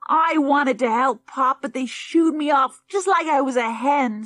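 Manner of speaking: put-on the sulky boy complains to Pop; aggrieved child. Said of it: "in an aggrieved tone".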